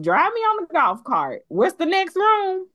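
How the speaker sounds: high excited mom voice; high voice delighted on a golf cart tour; delighted diva mom. Retold or imitated. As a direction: ride around thrilled and bossy. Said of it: "she would be like".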